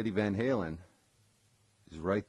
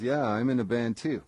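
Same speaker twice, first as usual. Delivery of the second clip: casual modesty; 5 semitones higher, 7 dB louder than usual. Retold or imitated. Eddie voiced in retold story.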